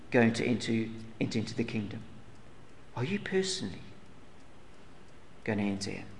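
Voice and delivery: hushed, searching question. Real, not acted. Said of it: "repeats question to hearers quietly".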